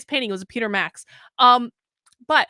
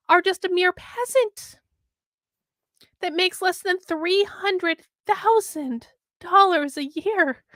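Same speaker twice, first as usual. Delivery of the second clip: higher, slow, sneering rich-snob voice; mock condescension; 6 semitones higher, slower than usual. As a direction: look down your nose at the peasants, slowly. Mocking the article's view of peasants.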